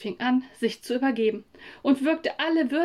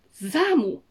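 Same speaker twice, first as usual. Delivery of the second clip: wütend; 5 semitones higher, 4 dB louder than usual. Acted. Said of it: "Meine Mutter wurde so wütend".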